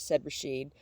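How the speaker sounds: ordinary delivery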